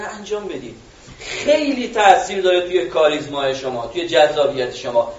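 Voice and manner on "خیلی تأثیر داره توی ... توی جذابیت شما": usual speaking voice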